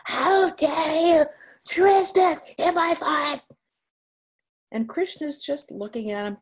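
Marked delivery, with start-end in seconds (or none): 0.0-3.5 high, loud, roaring monster threat. Acted. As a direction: shout it as an enraged monster, much higher. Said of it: "the third time he comes back".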